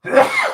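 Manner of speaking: loud real sneeze; sneezing. Real, not acted. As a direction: sneeze hard, mid-stream. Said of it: "Had a go again".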